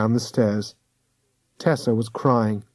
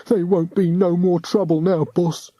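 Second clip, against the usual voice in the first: sly henchman; 6 semitones higher than usual. A narrator's reading. De Sniff to boss, odd voice.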